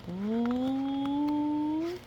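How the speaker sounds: high, held spooky ooh; suspense ooh. Acted. Reader building suspense for children, high and held. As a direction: stretch it out eerily.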